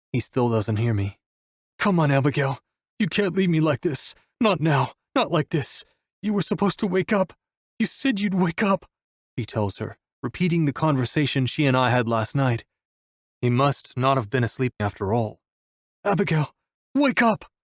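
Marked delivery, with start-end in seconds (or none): 1.8-8.9 raised pitch, grief-stricken begging; desperate pleading. A narrator's reading. Wolf begs Abigail not to leave him, desperate. 16.1-17.5 high-pitched desperate cry; narrated roar, pitch leaping up on the last words; mild roar. A narrator's reading. Wolf roars at Abigail to wake up, held-back volume. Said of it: "he roars at her".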